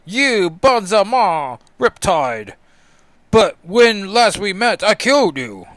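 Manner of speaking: loud, high, hammed-up confrontation; dramatic outcry. Colossus confronting Riptide whom he killed, loud theatrical outcry. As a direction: cry out like an astonished comic-book hero.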